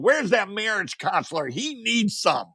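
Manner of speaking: storyteller imitates women in stores asking for the strain; mock customer voice. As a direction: pitch it up like eager shoppers. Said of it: "women were coming into the stores going".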